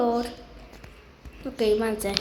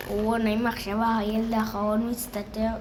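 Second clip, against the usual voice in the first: usual speaking voice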